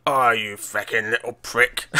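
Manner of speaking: slow, dying Cockney snarl; Cockney accent. Retold or imitated. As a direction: drop into full Cockney accent. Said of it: "it goes full Cockney".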